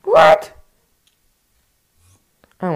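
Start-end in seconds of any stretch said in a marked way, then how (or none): 0.0-0.5 very high squeak; shocked squeal. Real, not acted. Gamer squealing in shock at the game.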